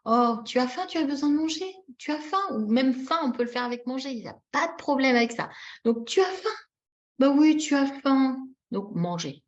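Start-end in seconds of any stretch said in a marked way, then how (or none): 0.0-2.4 high, coaxing parent questions; lifted baby-directed voice; talking to baby. Shown as a demonstration. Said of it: "Donc, on va lui dire". 6.1-8.4 put-on ask, then reassure a hungry baby; singsong parent voice; talking to baby. Shown as a demonstration. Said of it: "on va lui dire".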